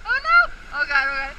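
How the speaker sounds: high, loud panicked cries; panicked screaming. Real, not acted. Big drop on the ride.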